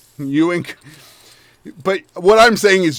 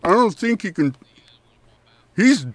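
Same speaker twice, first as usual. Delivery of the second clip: higher, louder, chewing candy goblin; cheerful goblin talking through a mouthful; mouth-full goblin Chucklehead; 7 semitones higher, 6 dB louder than usual. Acted. Chucklehead explains the maggot in his head, chewing.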